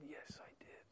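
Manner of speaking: whispered, hushed reply; awed whisper. Acted. Officer answering his comrade, whispered in awe. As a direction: breathe it in awe.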